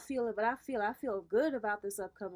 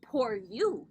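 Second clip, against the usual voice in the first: loud, emphatic lion's retort; mock pity for others; 6 dB louder than usual. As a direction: throw the pity back, hitting it loudly.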